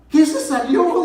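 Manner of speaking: high, loud demand to know; wife's shocked shout, reenacted; imitating alarmed wife. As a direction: yell the question back in disbelief.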